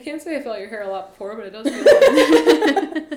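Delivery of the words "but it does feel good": laughing. Pretend. Laughing slow sultry joke.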